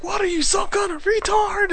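high, mocking imitation of an angry caller. Host imitates the angry caller, high and mocking. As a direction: ham up the furious caller's insult. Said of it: "He's like".

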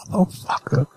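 whispered under the breath; mock whisper. Retelling the look he gave them, whispered. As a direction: mouth it in a hush. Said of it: "He looked right at us like".

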